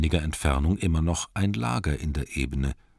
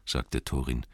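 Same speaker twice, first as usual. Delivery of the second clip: usual speaking voice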